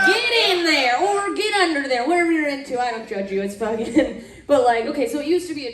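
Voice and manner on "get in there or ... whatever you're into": cheering on. Pretend. Cheering on a partner.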